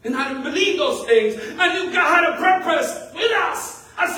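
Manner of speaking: high, loud, drawn-out proclamation; full-force preaching. Acted. Pastor declaring God's purpose to the church, full-force preaching. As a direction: proclaim it to the room with rising force.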